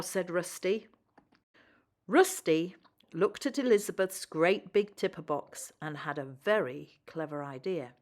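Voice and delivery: usual speaking voice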